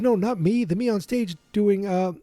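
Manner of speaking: high, excited retelling voice; a hint of a panicky kid; frantic Marty. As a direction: pitch up like a flustered teen explaining. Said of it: "He goes".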